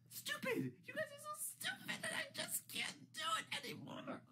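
high, sobbing outburst of exasperation; frustrated crying. Real, not acted. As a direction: cry it out, completely fed up. Rant peaks in frustrated tears.

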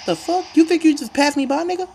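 high, startled shout at the passer; shocked trash-talk shout; outraged yell. Real, not acted. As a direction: yell it in disbelief. Outraged at player passing him.

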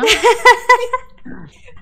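high burst of laughter; loud laugh. Real, not acted. Friends laughing at chase.

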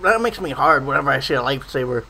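ordinary delivery

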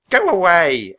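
high, loud shooing yell. A narrator's reading. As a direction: drive the birds off with your voice. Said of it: "a woman yelled at the ravens".

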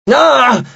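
high, short yell. A narrator's reading. Both halves of the goddess at once, yelling. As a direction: let out a sharp high cry. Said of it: "urlarono le voci all'unisono".

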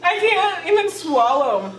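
strained, burning mouth; pained from spicy burn. Real, not acted. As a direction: talk through a burning throat. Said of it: "It hurts that much".

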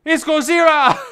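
high, loud monster alarm; panicked shout. Pretend. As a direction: scream the warning high and loud.

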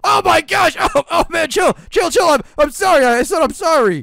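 high, loud frantic pleading; panicked. Acted. Sprayed boy panics and begs the principal to chill. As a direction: beg him to stop, flustered.

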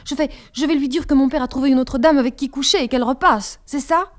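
loud, exasperated taunt. A narrator's reading. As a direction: push it to the edge of exasperation. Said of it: "aux bornes de l'exaspération". (exaspérée, forte et provocante)

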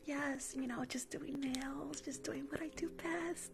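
fake sweet. Nail tech suddenly sweet to her client as the boss walks in. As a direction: turn on the charm for the boss.

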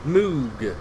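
Moog with a long oo, low and soft; settling a pronunciation. Shown as a demonstration. Streamer echoes the caller's answer, settling the pronunciation. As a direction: repeat Moog quietly, rhyming with fugue. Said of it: "How do you say it?".

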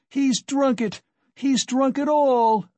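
high, loud cry of despair; desperate wail, drawn out at the end; despairing scream. A narrator's reading. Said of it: "the poor woman screamed in despair".

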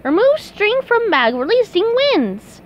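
high cartoon voice reading out instructions; SpongeBob reading. A narrator's reading. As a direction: read each step aloud in character. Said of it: "SpongeBob read".

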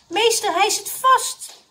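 high, loud, drawn-out alert; calling out. A narrator's reading. Mrs Rozenboom reporting the stuck man, calling out. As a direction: call urgently to teacher. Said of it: "riep mevrouw Rozenboom".

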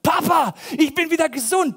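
put-on loud, high shout of a healed boy; jubelnder Ausruf. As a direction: burst out joyfully, calling to Papa. Healed boy runs to his father. (laut und hoch gerufener Jubel)

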